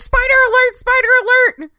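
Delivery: very high, loud alarm call; alarmed shout. Retold or imitated. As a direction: yell a warning, panicked. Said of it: "and I was like".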